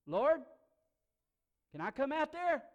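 loud, high, eager call; Peter calling out. Acted. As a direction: shout a request across the water. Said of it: "he looks out at Jesus and he says".

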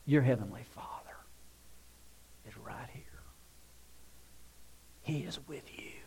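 very soft, nearly whispered comfort; soft, intimate preaching; hushed reassurance. Real, not acted. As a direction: reassure them quietly, with long pauses.

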